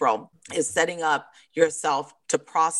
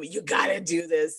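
ordinary delivery

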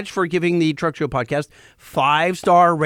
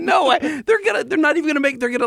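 laughing protest; 10 semitones higher, faster than usual. Real, not acted.

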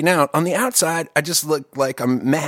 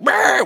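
high-pitched cartoon startle noise; Wile E. Coyote yelp; 11 semitones higher, 3 dB louder than usual. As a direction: let out a short Looney Tunes shriek. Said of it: "like, Looney Tunes".

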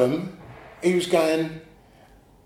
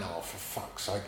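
muttered curse; 7 semitones lower, 16 dB quieter than usual. Retold or imitated. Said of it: "and I'm going".

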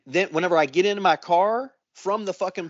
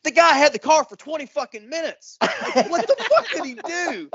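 high, disbelieving rant breaking into a laugh; incredulous outrage; 11 semitones higher than usual. Retold or imitated. Speaker ranting to the mechanics about the wrecked car, high and incredulous. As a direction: pitch way up in disbelief, laughing at the end.

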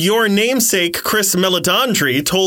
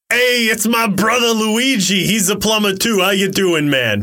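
put-on hammy Brooklyn plumber accent, slightly raised; Chris Pratt as Mario; 4 semitones higher than usual. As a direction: greet them as a Brooklyn working man. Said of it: "I'll play the Mario one first".

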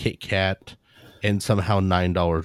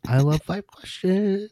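soundbite voice; 10 semitones higher, slower than usual. Shown as a demonstration. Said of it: "we gotta say".

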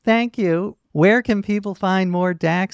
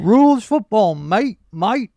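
bad Australian accent; 4 semitones higher, 4 dB louder, slower than usual. Shown as a demonstration. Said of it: "it's so bad".

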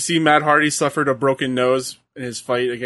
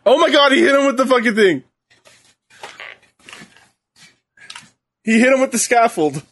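high, fast, stunned call-out; excited exclaiming; 9 semitones higher than usual. Real, not acted. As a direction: blurt it out, amazed. Reacting live to the hit.

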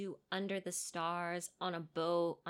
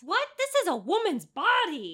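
high and loud indignant cry; mock outrage; 9 semitones higher, 8 dB louder than usual. Cohost reacting to people taking the goddess's rocks, outraged. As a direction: protest it like it's sacrilege.

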